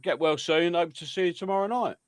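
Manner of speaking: ordinary talk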